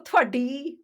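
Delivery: demonstrating an angry tone, fairly loud; mildly cross demo, end lifted; mock anger. Shown as a demonstration. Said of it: "If you say it with anger".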